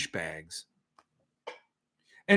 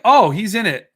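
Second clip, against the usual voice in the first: loud, raised "oh" of discovery; surprised realization; 6 semitones higher, 9 dB louder than usual. Real, not acted. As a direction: react out loud as the news lands. Learns author is included.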